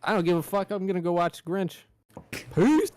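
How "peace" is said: much higher squeaky peace out; high mock farewell. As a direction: sign off in a squeal. Leaving podcast jokingly.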